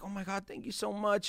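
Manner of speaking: high, tearful mock thanks; almost in tears. Woman thanking him for the jacket, almost in tears. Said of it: "She's almost in tears for the jacket".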